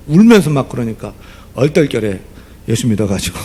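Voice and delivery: ordinary talk, nothing marked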